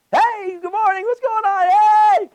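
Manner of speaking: high, over-the-top cheery morning hello; happy greeting. Retold or imitated.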